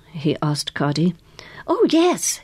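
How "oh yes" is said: high, eager agreement; girlish eagerness. A narrator's reading. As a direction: agree keenly with a big pitch lift. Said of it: "she said eagerly".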